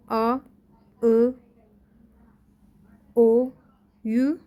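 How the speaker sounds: آ، او، او، یو each said as its own drawn-out vowel; back vowels listed. Shown as a demonstration. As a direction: sound out آ، او، او، یو one by one, clearly. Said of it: "کلن اونلو را داشتیم".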